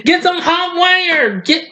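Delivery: hammed-up high yell about dog germs; over-the-top dying yell. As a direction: scream it like you've been poisoned by dog germs. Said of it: "I got carried away".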